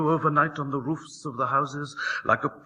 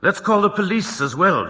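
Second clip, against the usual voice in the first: put-on eager boy; 8 semitones higher, 5 dB louder than usual. A narrator's reading. Boy eager about the fire.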